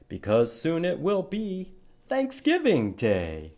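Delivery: put-on lively sing-song poem for children; poem recitation. Teacher reciting a turkey poem to young children, sing-song. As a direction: recite it with playful rises and drops.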